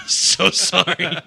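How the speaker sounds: loud, high, drawn-out laughing apology. Real, not acted. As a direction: apologize loudly through real laughter. Apologizing amid laughter at own joke.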